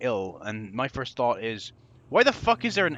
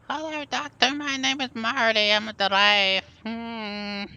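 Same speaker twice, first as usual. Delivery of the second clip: much higher put-on giraffe voice; 9 semitones higher, slower than usual. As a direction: squeak out the giraffe's lines. Reading giraffe's dialogue aloud.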